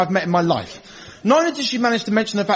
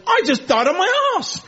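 air hostess voice; 9 semitones higher than usual. Acted. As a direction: play a flight attendant moaning that she bombed. Hostess complaining backstage after bad demo.

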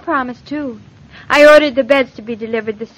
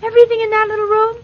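surprised; 10 semitones higher, 4 dB louder, faster than usual. Acted. Shocked at crowded bedroom.

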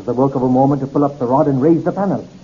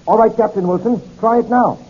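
higher-pitched call through a door; 6 semitones higher than usual. Acted. Narrator telling the captain to try the door, calling out. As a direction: call to someone in the next room.

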